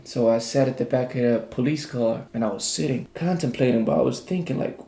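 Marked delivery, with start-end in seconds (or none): none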